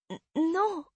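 bégayer un non très aigu; fillette effrayée. A narrator's reading. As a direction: toute petite voix d'enfant apeurée. Fillette bégaie devant son père. (réponse hésitante et haut perchée)

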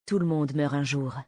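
low, unmoved killer's reply; chillingly matter-of-fact; cold composure. Acted. Armed woman answers the begging boy.

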